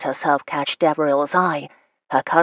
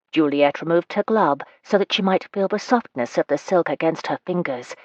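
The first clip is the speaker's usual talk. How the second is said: ordinary delivery, nothing marked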